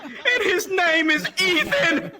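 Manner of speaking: loud, very high hammed-up rapture; miracle proclamation. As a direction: cry it out in mock religious ecstasy.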